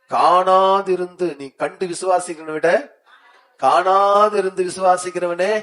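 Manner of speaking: solemn scripture reading. Acted. Pastor reads the scripture aloud to the church, slow and solemn. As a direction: read the verse slowly and boldly. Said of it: "நான் இப்ப வாசி".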